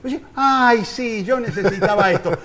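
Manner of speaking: high, loud put-on gush; mock delight. Imagined user thanking the algorithm for its offer, high mock delight.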